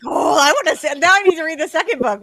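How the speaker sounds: high, loud, hurried mock astonishment; excited reader reaction. Author imitates herself hearing a cliffhanger twist, excited. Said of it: "I was like".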